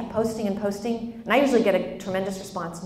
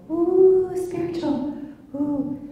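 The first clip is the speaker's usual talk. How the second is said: high, drawn-out spooky "ooh"; hammed-up airy wonder; mock awe; 8 semitones higher, much slower than usual. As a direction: coo it high, as if mystically impressed. Mocks spiritual-only approach.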